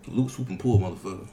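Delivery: ordinary delivery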